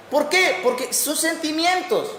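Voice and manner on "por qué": ordinary talk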